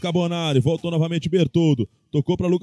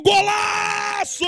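explosive goal commentary; 13 semitones higher, much slower than usual. Real, not acted. Goal scored, commentator screams.

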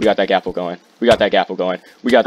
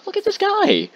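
extremely high falsetto exclamation; squeaky, exasperated outburst; incredulous squeal. Real, not acted. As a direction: voice leaping right up in disbelief. Exclaims at the hacking player.

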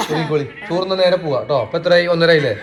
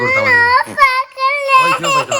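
incredulous. Real, not acted. Exasperated high question.

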